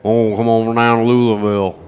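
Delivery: loud hammed-up Louisville local; mock Louisville accent. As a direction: call out an invitation in a caricatured Louisville accent. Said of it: "an accent".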